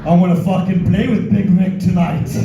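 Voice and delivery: lightly voiced eager wife; mock wife voice. Voicing a wife in sex shop.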